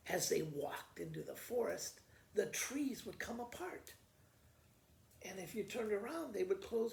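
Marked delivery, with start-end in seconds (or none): none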